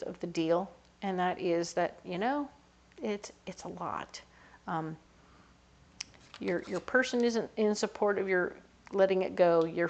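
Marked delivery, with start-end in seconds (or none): none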